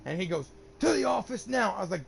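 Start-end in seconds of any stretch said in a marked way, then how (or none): 0.8-1.7 high, loud mock command; retold angry order. Imitating teacher sending student to the office, angry order. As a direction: send him out with a raised voice.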